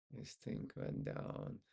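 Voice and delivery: This thing went down whispered low and slow; whispered aside. Shown as a demonstration. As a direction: mutter This thing went down under your breath, low and slow. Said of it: "repeated under breath".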